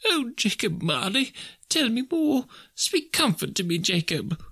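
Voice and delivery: high, desperate pleading; imploring. A narrator's reading. Scrooge begging Jacob Marley for comfort, imploring. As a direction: beg for a word of comfort. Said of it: "he said imploringly".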